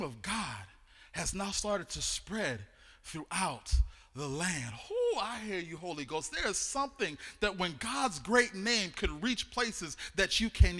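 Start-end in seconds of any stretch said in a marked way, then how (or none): none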